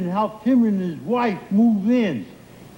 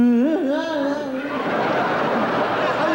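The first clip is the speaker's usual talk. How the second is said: long rising giggle; can't stop giggling; giggling fit. Acted. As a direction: break up in helpless giggles. Laughing his head off.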